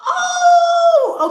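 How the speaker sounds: high, shouted, drawn-out "oh"; retold outrage. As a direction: let out a mock-furious high shout. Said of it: "okay, I was so mad".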